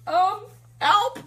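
high, loud comic cry for help; mock plea for help. Looking around the street for rescue, mock-panicked. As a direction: squeak it out in mock alarm.

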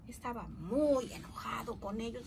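plain reading voice

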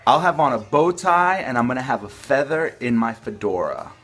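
ordinary talk, nothing marked

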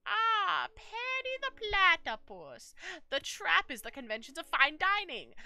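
high, slow, gloating villain voice; cartoon villain. Vlogger plays cartoon villain at the dinner table, hammed-up gloating.

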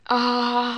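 long, loud "aah" of alarm; pained cry. Real, not acted. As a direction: cry out as you get hit. Hit by an enemy.

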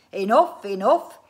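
loud, cutting interruption; exasperated cry. A narrator's reading. Inventor silences complaining family, crying out. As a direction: cut them off loudly. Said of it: "cried Mister Winsborough".

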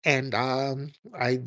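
mock pickup lines in an even monotone; hesitant parody of the caller flirting; imitating club dude. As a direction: play the awkward foreign suitor, haltingly. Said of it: "one of these dudes buy you a drink".

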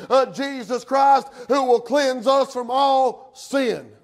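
loud, high, driving sermon cadence; full-force preaching. Real, not acted. Preacher warning the church they're no Samson, full-force preaching. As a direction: hammer each phrase from the pulpit.

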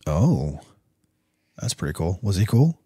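ordinary talk

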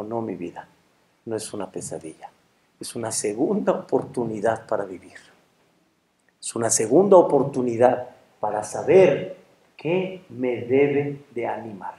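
low, soft, tender reply; hushed realization. Acted. Husband telling his wife of a second chance, hushed. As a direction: tell it quietly, slowing toward the end. Man awakens grateful, answers wife.

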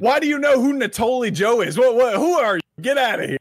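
high, loud mock interrogation; hammed-up disbelief, throwing the newcomer out; incredulous grilling. Imitates the suspicious questioner, hammed up.

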